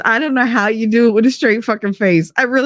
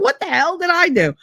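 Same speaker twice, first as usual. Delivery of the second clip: mock bafflement; 10 semitones higher than usual. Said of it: "I was like".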